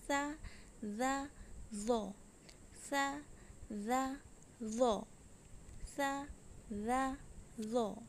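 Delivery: सा जा जो, each syllable set apart with a pause; tongue tip on upper teeth for सा जा जो; letter drill. Shown as a demonstration. Teacher drilling सा जा जो for students, measured. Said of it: "ऊपर के दांतों के अंदरूनी किनारे से".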